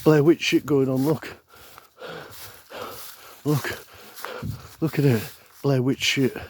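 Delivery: high, loud pretend alarm; playful horror-film alarm; mock panic. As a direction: call out in hammed-up panic. Playing panic in haunted forest.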